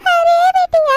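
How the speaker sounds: loud, high anguished call; high wailing cry. Acted. Mother crying out to her daughter, high wailing shout.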